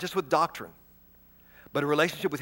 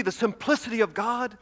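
ordinary talk, nothing marked

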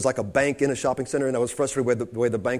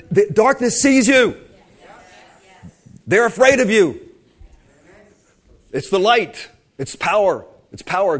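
raised, high-pitched preacher's proclamation; emphatic preaching; 6 semitones higher, 6 dB louder than usual. Real, not acted. Preacher telling believers the darkness fears them, proclaiming forcefully. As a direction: punch out each short declaration to the congregation.

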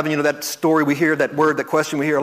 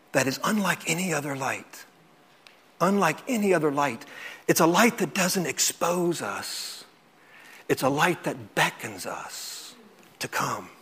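hushed, fading; 2 semitones lower, 8 dB quieter than usual. Real, not acted. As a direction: let the voice sink softly away as the light beckons. Preacher softens on the beckoning light.

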